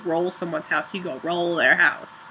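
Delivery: normal talking voice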